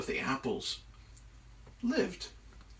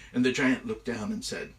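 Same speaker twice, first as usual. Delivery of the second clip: usual speaking voice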